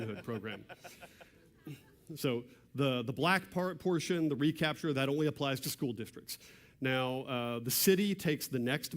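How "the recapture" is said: ordinary talk, nothing marked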